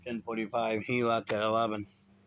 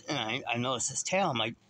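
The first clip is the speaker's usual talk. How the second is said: usual speaking voice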